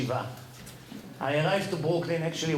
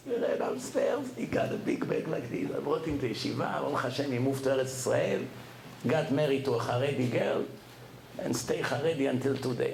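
normal talking voice

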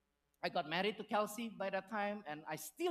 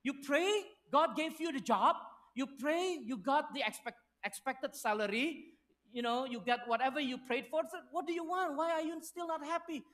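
higher and louder, exasperated wife; wife's exasperated voice; 6 semitones higher, 5 dB louder than usual. Retold or imitated. Wife scolding her unhappy husband, higher and louder. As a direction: push back at him like a fed-up wife. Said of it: "and Kelsey's like".

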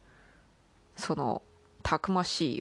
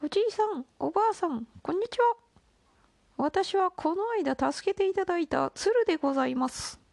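put-on much higher, sweet young girl voice; high crane girl voice; 12 semitones higher, 3 dB louder than usual. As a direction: squeak it out like a tiny bird maiden. Crane speaks to old couple.

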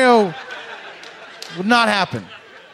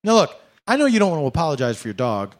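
ordinary delivery

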